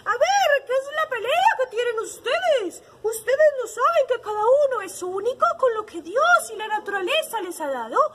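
high, loud parrot voice; scolding parrot. A narrator's reading. Parrot telling off quarrelling hen and sheep, scolding. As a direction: squawk a reproach like a storybook parrot. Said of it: "Y el lorito les dijo".

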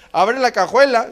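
usual speaking voice

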